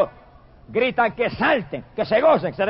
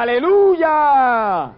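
loud, high, stretched-out cry; shouted hallelujah; 4 semitones higher, 4 dB louder, much slower than usual. Acted. Preacher voicing the heavenly multitude, drawn-out shout. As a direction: let the multitude's joy ring out slowly. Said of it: "y gritaba".

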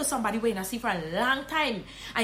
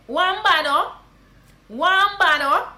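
loud Guyanese Creole hail; slow, loud call to a friend, Guyanese; Guyanese greeting; 5 semitones higher, 6 dB louder, slower than usual. Shown as a demonstration. Guyanese teacher hailing a friend. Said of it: "you can also say".

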